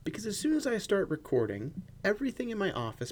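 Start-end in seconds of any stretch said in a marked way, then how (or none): none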